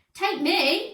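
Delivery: high, eager begging. A narrator's reading. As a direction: plead like a needy kid. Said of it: "begged Brainy Brian".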